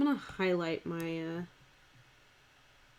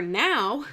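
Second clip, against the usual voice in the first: "now" pushed louder with a pitch swoop; emphasized NOW; 3 semitones higher, 6 dB louder than usual. Shown as a demonstration. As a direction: lean hard on "now". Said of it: "I'm gonna emphasize it".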